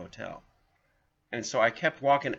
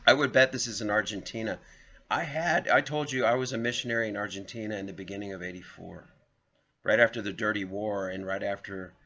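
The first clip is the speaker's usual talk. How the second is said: ordinary talk, nothing marked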